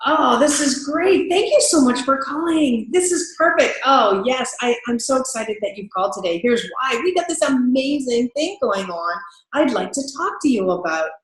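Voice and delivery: thrilled the customer called; eager salesperson welcoming a caller; enthusiastic. Shown as a demonstration. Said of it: "enthusiasm kind of sounds like".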